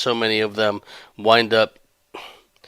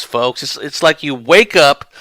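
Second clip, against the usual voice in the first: usual speaking voice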